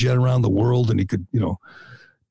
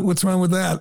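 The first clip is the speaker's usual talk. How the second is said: mock innocent; 8 semitones higher than usual. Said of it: "maybe she thought".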